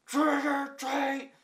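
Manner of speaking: very loud, drawn-out high holler; slow, full-volume mock trick-or-treat yell; imitated dad screaming. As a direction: scream it as loud as you can at closed doors. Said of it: "he just screams".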